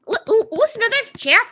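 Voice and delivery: high-pitched mock British accent. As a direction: stammer into a put-on British accent. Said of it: "like, with a British accent".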